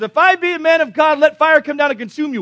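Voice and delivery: loud, high-pitched acted proclamation; thundering pronouncement. Elisha answering the captain with fire, loud proclamation. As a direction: call down judgment at full voice.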